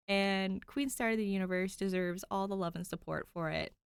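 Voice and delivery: usual speaking voice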